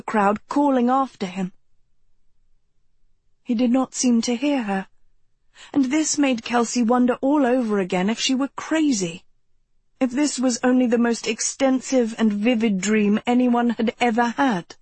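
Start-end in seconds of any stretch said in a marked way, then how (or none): none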